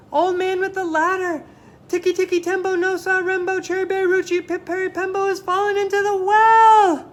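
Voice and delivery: very high, very loud desperate cry; last-breath shout. Acted. Chang shouts his last breath at the old man, very high and loud. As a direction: give it everything you have left. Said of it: "with his very last breath".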